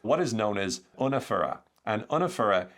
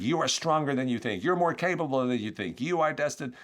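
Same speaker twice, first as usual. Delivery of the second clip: motivational speaker; 6 semitones higher than usual. Acted. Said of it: "motivational speaker that might say".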